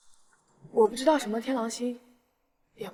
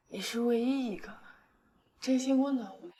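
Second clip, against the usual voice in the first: usual speaking voice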